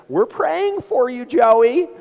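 high, drawn-out mock family voice; singsong mock concern, stretched out high; pious family member. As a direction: imitate a cloying praying relative. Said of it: "when their family says".

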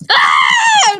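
sudden loud high scream; real jump-scare scream; startled scream. Real, not acted. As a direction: shriek as the killer appears. Startled in game.

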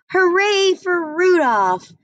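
high, loud cheering shout; excited screech. A narrator's reading. Crowd cheering Rudolph's landing, high excited screech. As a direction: cheer him like a thrilled crowd. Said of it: "then started to screech".

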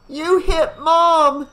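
put-on slow, high, loud child's accusation; crying back. A narrator's reading. Daughter in canoe to her father, accusing cry. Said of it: "Tina cried back to him".

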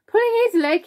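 very high, loud, hysterical disbelief; gleeful cry. A narrator's reading. Centipede in hysterics mocking the earthworm, crying out. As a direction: cry it out, beside yourself with glee. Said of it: "he cried".

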